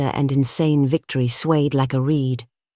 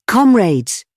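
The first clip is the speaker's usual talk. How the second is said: loud, high call to the room; passionate cry; 8 semitones higher, 6 dB louder than usual. A narrator's reading. Gregory appealing to his comrades, passionate cry. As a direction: cry out to rally them. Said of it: "he cried out".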